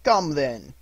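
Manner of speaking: loud, cheerful comeback; light-hearted retort; gaily. A narrator's reading. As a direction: answer back merrily. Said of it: "returned the nephew gaily".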